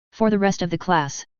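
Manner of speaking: ordinary delivery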